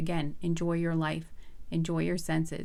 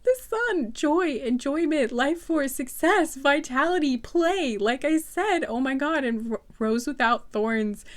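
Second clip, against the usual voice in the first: excited; 4 semitones higher, 5 dB louder than usual. Real, not acted. Still thrilled after the card reveal.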